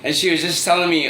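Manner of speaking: usual speaking voice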